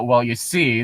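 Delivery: ordinary delivery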